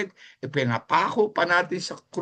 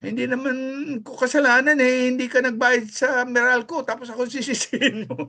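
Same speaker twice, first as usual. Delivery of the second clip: the devil pleading his innocence, pitched up; the devil talking; 7 semitones higher than usual. Retold or imitated. Preacher voicing the devil complaining to the congregation, high and whiny. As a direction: play the devil whining that it isn't his fault. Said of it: "Kung pwede lang magsalita ang demonyo".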